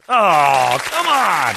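loud, high cry of dismay; exasperated outcry. Acted. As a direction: wail it as a mock protest. Reacts to the crushing last line.

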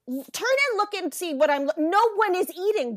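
indignant. Retold or imitated. Customer arguing with the desk worker, indignant. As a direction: argue back in disbelief, pitch jumping up.

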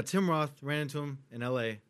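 normal talking voice

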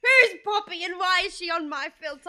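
high, slow comic holler; hammed-up bewildered yelling; mock outraged shout. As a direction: play along, loudly demanding an answer. Partner joins the silly shouting.

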